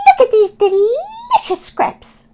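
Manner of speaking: high, loud squeaky rat greeting. Acted. Robert the rat meeting Oscar, high squeaky hello.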